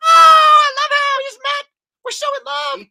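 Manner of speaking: very high squeal, bursting out loud at first; hammed-up lovestruck shriek; gushing Anna. Younger sister insists on her new love, shrieky high gushing. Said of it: "she's over there, and she's like".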